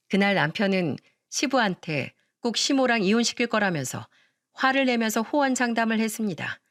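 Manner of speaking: ordinary delivery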